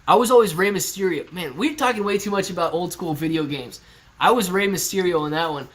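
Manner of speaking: ordinary talk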